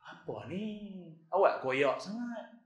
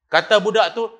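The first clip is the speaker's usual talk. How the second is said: ordinary delivery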